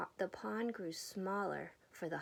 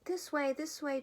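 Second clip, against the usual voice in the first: chirped; 6 semitones higher, 3 dB louder than usual. A narrator's reading. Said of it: "chirped the bird".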